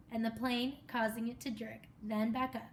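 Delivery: usual speaking voice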